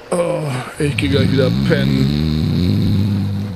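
slow groggy hungover groan. Acted. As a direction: drag it out half asleep. Hungover, wants to sleep.

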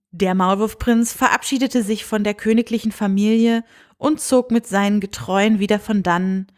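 usual speaking voice

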